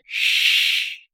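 sharp, voiceless hiss; angry cat hiss. A narrator's reading. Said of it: "зашипел в гневе кот".

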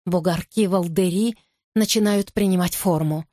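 ordinary delivery

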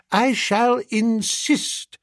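high, slow, resolute headmistress; decided insistence. A narrator's reading. Headmistress vowing to overrule any modest refusal, decidedly. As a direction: draw it out high and firmly. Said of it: "said Miss Tomlinson decidedly".

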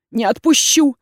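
firm loud "Не отпущу!"; defiant cry. A narrator's reading. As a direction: refuse outright, raising the voice. She refuses to let go.

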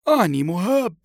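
high, loud decisive declaration; grandfather's emphatic resolve; firm, resolute. A narrator's reading. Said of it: "فقال الجد بحزم".

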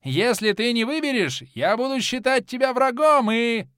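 high, loud threatening yell; shouting. A narrator's reading. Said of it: "продолжил кричать Кармадонт".

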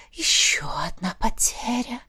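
soft, whispered sigh. A narrator's reading. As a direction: sigh it in a mock-mournful whisper. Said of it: "вздохнул Хаос". (тихий шёпот со вздохом)

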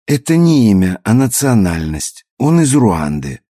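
insistent, lightly acted explanation; emphatic correction. A narrator's reading. Margo corrects his mistake.

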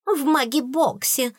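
high-pitched taunting echo; mocking mimicry. A narrator's reading. Spiteful fairy parroting the princess, high and mocking. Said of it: "передразнила зубная вредина".

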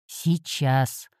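a low grumbled reply. A narrator's reading. Heroine grumbles at cat getting up, low grumble. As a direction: grumble it, half asleep. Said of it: "пробурчала я".

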